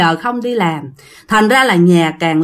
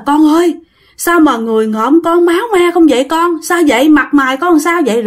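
higher-pitched, urgent worried questions; acted motherly alarm, pitched up; 6 semitones higher than usual. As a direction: voice a mother distressed at seeing her son hurt. Mother voiced, alarmed at bloodied son.

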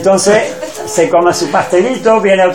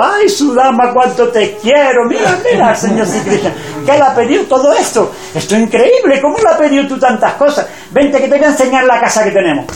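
higher, bubbling with delight; excited wife; 6 semitones higher than usual. Acted. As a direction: gush over him like an overjoyed wife. Wife excitedly shows new riches. (esposa eufórica enseñándole la casa, voz más aguda y alegre)

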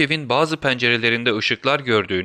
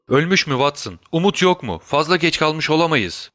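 higher-pitched, worried questioning; anxious alarm; 5 semitones higher than usual. A narrator's reading. Holmes asks Watson if the woman is dead, alarmed. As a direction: plead against the worst, alarmed.

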